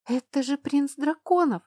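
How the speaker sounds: high, loud squeak of alarm; a scared girl protesting, high and loud; frightened squeak. A narrator's reading. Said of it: "пискнула Ютина".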